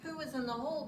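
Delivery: usual speaking voice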